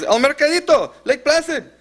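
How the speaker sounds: put-on very high, panicky Spanish-accented cry; imitated panicking caller. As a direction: act out a caller pleading in panic. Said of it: "And the caller panics".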